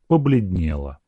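plain reading voice

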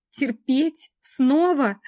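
high, loud, tearful disbelief; despairing disbelief. A narrator's reading. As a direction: cry out in disbelief. Heroine's incredulous despair.

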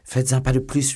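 high, loud warning; desperate threat. Acted. Milady retells warning her pursuer, knife drawn, threatening. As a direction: warn them off with full urgency.

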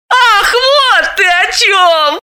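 high, slow, laughing realisation; joyful realisation. A narrator's reading. Galina realises what her friend means, laughing delight. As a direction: laugh as it finally dawns. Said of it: "радостно прозрела Галина".

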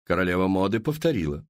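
ordinary delivery

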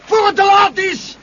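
screamed high and loud, dragged out; desperate shout. Acted. Pilot screaming at Charlie to shoot the beasts, desperate. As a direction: yell at him to fire before it's too late. Creatures attack, urges shooting.

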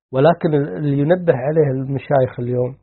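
ordinary talk, nothing marked

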